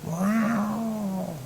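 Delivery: high eerie cat wail; a long spooky cat meow; cat cry. Acted. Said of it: "they cried".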